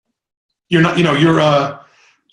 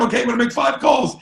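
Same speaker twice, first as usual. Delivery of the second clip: excited; 8 semitones higher, faster than usual. Pretend. Pumped caller talking to self.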